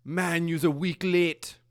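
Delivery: teasing a late reporter, slow and loud; heckling local. Retold or imitated. Customs officer heckles the German reporter, slow mocking taunt. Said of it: "And the guy goes".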